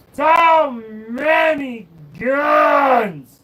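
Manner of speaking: very slow, high, loud cry of delight; mock Russian joy at the guns, loud; shouted excitement. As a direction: yell it out in awe at the gun wall. Crying out in Russian voice.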